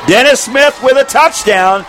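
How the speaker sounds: excited. Real, not acted. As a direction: call the score with a lift. Touchdown call.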